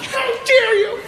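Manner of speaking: very high, drawn-out "How dare you?"; mocking a prissy man. Comic mocking the annoyed husband in a high camp voice. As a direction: squeal it up high like an offended dandy. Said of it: "This guy's like".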